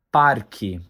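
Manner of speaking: "parque" with the R clearly sounded before the K; standard pronunciation. Shown as a demonstration. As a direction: say "parque" as PAR-kee, R pronounced, falling at the end. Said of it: "The correct pronunciation is".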